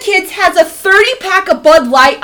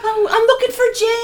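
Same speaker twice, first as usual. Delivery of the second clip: high, small, softer voice; mimicked kid; 7 semitones higher, 5 dB quieter than usual. Mother mimicking kids showing up, small high voice. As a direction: mimic a timid kid at the door. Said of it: "this little mousy voice, this little girl voice".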